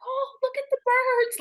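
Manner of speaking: high, loud, melting with wonder; gushing over birds. Retold or imitated. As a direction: swoon over the birds like a softie. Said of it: "I'm like".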